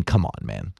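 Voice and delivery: ordinary talk, nothing marked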